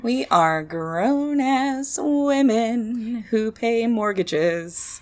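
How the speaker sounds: slow, emphatic mock pride; mock proud. Real, not acted. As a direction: declare it with ironic dignity. Mock-proud ironic declaration.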